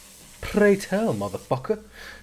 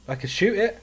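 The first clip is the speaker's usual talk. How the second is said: ordinary talk, nothing marked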